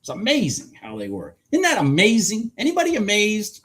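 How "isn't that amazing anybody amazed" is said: high, loud mock-excited question; mock hype. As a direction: hype up the audience sarcastically. Mock-hyped question to the audience.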